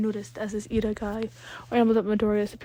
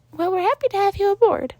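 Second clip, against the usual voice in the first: high, louder, sincere principal; sincere high Nezu; 11 semitones higher, 5 dB louder than usual. Acted. Nezu welcomes Danny aboard, high and sincere. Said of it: "Nezu's sincerity shone through his voice".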